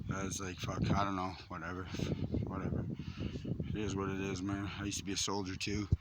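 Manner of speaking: deflated, almost to himself; quiet and upset. Real, not acted. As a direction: talk yourself down, drained and hurt. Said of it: "I'm just upset".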